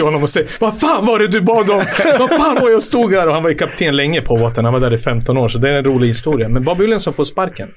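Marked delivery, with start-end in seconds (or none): 0.6-3.3 high, incredulous retold complaint; mock indignant outburst. Captain confronting him at halftime, mock indignant. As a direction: imitate a teammate's exasperation, half laughing.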